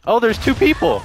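high startled shout; suddenly spotting two enemies; surprised. Real, not acted.

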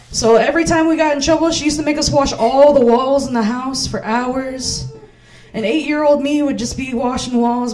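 ordinary talk

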